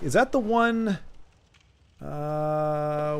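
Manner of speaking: normal talking voice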